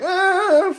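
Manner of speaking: high, drawn-out "aah"; hammed-up flailing cry; mock cry of alarm. Vampire's victim dangling by the throat.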